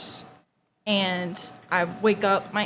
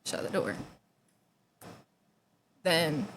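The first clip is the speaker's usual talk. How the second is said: ordinary talk, nothing marked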